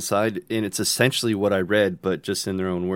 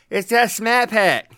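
mock Boston accent; 12 semitones higher, 6 dB louder than usual. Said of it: "And he's like".

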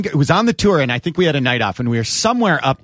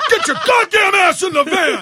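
yelling at bandmate; 14 semitones higher than usual. Retold or imitated. To drunk Goop at the bar, angry yell. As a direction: yell him into the van like a kid. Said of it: "I had to yell at my own guys like kids".

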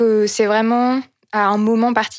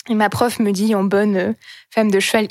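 normal talking voice

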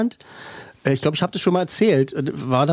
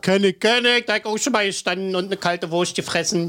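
higher-pitched mock Berliner; Berlin dialect; 4 semitones higher than usual. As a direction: play the knowing local in broad Berlin dialect. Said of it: "wo der Berliner halt sagt".

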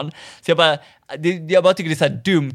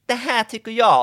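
slightly mimicked young woman, pitched up; lätt härmad ung tjej; 10 semitones higher than usual. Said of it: "tjugoett-årig, femtioett kilo tung tjej". (lite härmad ung tjej med ljusare röst)

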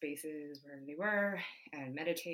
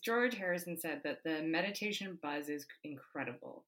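ordinary talk, nothing marked

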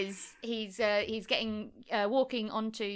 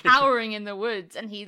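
ordinary talk, nothing marked

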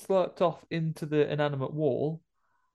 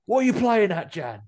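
indignant ghost; 9 semitones higher, 6 dB louder than usual. Retold or imitated. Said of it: "He says".

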